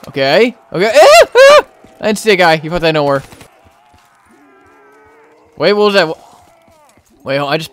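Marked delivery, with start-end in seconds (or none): 0.9-1.7 two very high, loud yelps; startled shrieks. Real, not acted. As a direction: jump with fright and shriek. Surprised by unseen zombie.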